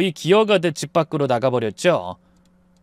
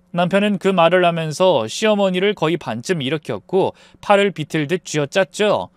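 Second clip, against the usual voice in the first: plain reading voice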